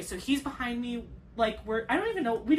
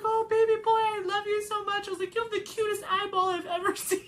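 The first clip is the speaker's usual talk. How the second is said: much higher, cooing to a newborn; tender baby talk; 11 semitones higher than usual. Retold or imitated. New mother cooing at her baby boy on her chest, high tender baby talk. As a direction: melt over the baby, half laughing, half crying.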